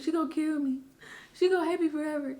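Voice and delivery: high, tearful rush of fear; retold crying panic. Teen on porch after mom found note, tearful panic. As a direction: sob out the dread of losing mom. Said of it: "and I was crying".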